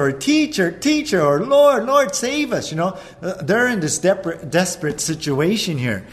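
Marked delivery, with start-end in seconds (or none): 0.1-1.2 high, loud panicked cry; frantic high call to the teacher; desperate yelling. Acted. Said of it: "They're all yelling". 1.4-2.7 high, loud plea to be saved; panicked high shout to the Lord; desperate yelling. Acted. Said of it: "They're all yelling".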